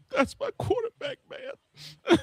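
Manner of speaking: high, over-the-top shout of devotion; mock emotional fan. Mocking his friend's fan pride, hammed-up high shout. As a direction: ham it up like a weepy superfan. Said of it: "You'd be like".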